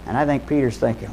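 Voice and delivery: ordinary delivery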